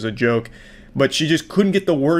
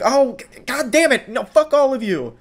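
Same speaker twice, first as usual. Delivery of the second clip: mock Karen outburst; 11 semitones higher than usual. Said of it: "And then she's like".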